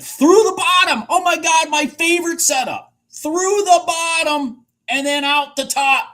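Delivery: high, loud, bursting with glee; excited shout. Real, not acted. Trader shouting at his favorite setup, thrilled and loud. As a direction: yell it out, thrilled at the chart.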